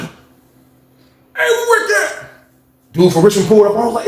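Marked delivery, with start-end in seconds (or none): 0.0-2.2 squeaky high voice for the other man; high mock voice. Retelling the jail cellmate calling out, high voice. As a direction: pitch way up, thin and strained. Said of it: "Man, man was like".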